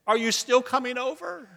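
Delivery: sheepishly hope the offer still stands; very meekly. A narrator's reading. Caller who misdialed asking the stranger meekly. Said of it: "the voice said very meekly".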